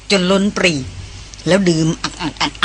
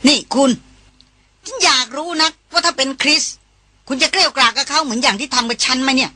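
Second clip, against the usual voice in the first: higher, tearful, wounded complaint; hurt reproach; 6 semitones higher than usual. Acted. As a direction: accuse him, feelings hurt. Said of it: "ต่อว่าไปอย่างน้อยใจ".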